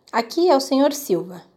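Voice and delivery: usual speaking voice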